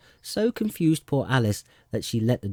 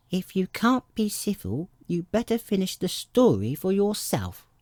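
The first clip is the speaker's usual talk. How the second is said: sulky; 11 semitones higher than usual. A narrator's reading. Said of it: "the Dormouse sulkily remarked".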